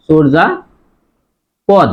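চর্যাপদ slowly as "chorja... pod"; long pause before "pod" in চর্যাপদ; slow split model of চর্যাপদ, "chorja, pod"; syllable by syllable. Shown as a demonstration. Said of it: "ছয় ওকার হবে".

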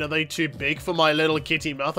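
ordinary delivery

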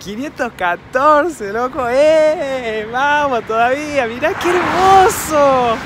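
high, slow, thrilled exclamations; excited. Real, not acted. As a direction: gush over the bus with delight. Bus fan thrilled by the bus.

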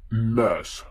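ordinary delivery

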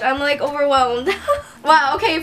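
ordinary delivery

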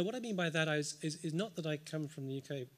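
ordinary delivery

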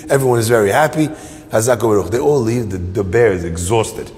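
usual speaking voice

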